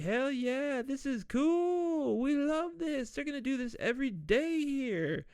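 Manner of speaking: high, excited partygoer voice; cheering crowd. Retold or imitated. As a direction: whoop it up like a wild crowd. Crowd cheering over Swan.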